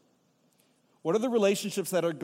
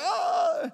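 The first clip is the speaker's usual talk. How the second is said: high, loud "aah"; comic high-pitched yelp; mock scream; 13 semitones higher, 7 dB louder than usual. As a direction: cry out in put-on alarm. Acting out reaction to marriage question.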